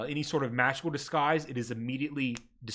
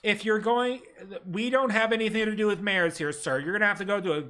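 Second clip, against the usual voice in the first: higher, prim bureaucratic clerk voice; desk clerk; 5 semitones higher than usual. Acted. Ministry clerk greets the ragged party, primly bureaucratic. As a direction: recite the rules like a tired official. Clerk dismisses mayor request.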